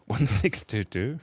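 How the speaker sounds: slow and loud, digit by digit; exaggerated slow repeat. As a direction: draw out each number with extra punch.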